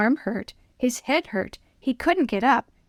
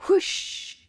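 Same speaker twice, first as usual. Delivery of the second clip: whoosh sound; 10 semitones higher than usual. A narrator's reading. Sled rushes down.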